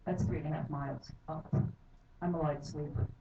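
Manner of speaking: ordinary talk, nothing marked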